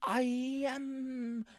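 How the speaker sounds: high, halting, drawn-out oddball voice; [name]. Acted. Oddball neighbour playing the Mad Titan, high halting voice. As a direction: stretch each word out high, with long hesitations. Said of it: "are you [name] doing Thanos?".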